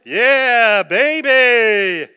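joyful whoop. Retold or imitated. Preacher leaving the mayor's house, whooping loud for joy. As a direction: whoop it out like you just won. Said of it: "you know what I did?".

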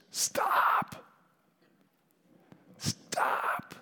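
drawn-out call, dropping away; echoed yell, fading. Retold or imitated. Preacher echoing the yell as God's message, fading call. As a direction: repeat the yelled command, easing off into breath. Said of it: "I'm yelling".

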